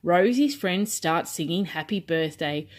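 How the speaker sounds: usual speaking voice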